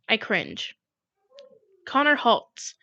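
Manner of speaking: usual speaking voice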